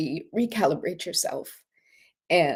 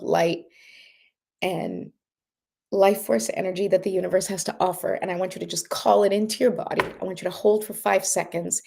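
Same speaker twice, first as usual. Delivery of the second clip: guided breathing exercise. Acted. Leads breathing meditation.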